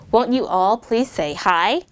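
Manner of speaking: normal talking voice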